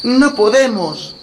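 put-on high, slow worried girl; little girl voice. A narrator's reading. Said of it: "añadió Gretel".

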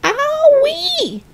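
very high squealed yell; pained yelp. A narrator's reading. Grandma as the mixture hits her, yelling. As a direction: shriek of sudden pain, leaping way up. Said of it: "Grandma yelled".

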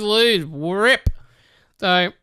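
ordinary talk, nothing marked

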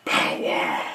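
very low, hoarse whispered growl; Batman. Acted. Batman signs off with the vlogger's catchphrase, deep rasping growl. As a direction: rasp it like a brooding superhero, drawing out the final words. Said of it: "and me, Batman".

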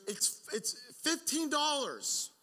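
high, slow, drawn-out mock yell; imitating dad calling out. Dad to son chasing the runaway kite, high strained call. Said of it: "and he goes".

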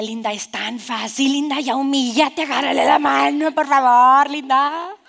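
acted exasperated begging; mock pleading. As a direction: beg with exaggerated urgency, building to the last words. Inner voice pleading with her.

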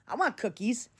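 ordinary talk

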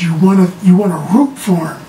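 ordinary talk, nothing marked

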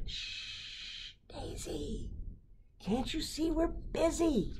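hushing. Acted. Busy parents hushing child.

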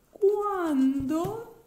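ordinary delivery, nothing marked

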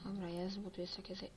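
usual speaking voice

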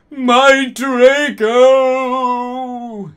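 high, drawn-out wail; moaning. A narrator's reading. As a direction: moan the name out, hammed-up. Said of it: "he moaned".

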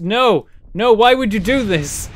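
loud, high cry of protest; real dismay. Real, not acted. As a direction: protest loudly as it goes wrong. Craft spins out of control.

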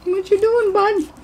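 very high coo at a puppy. Real, not acted.

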